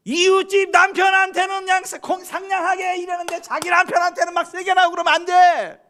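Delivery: high, loud pulpit shouting; full-force preaching. Acted. Preacher scolds wives over treating neighbours' husbands better, full-force preaching. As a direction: thunder a rebuke at the congregation.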